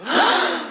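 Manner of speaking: short high gasp of fright; a quick frightened intake of breath; sharp gasp. A narrator's reading. Girl startled by wolf.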